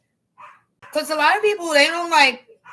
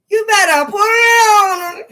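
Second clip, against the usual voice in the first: louder, higher, slower shout; 10 semitones higher, 11 dB louder than usual. Real, not acted. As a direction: yell it out to the women. Loud urging to women.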